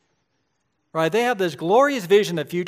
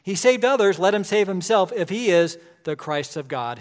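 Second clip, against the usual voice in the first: loud, higher mocking jeer; scoffing; 4 semitones higher, 6 dB louder than usual. Rulers at the cross jeering at Jesus, loud mockery. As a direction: sneer it out like a crowd taunting. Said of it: "the rulers scoffed at him".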